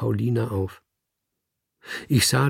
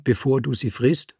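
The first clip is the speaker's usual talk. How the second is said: ordinary delivery, nothing marked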